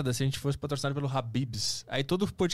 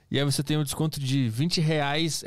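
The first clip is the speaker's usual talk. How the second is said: normal talking voice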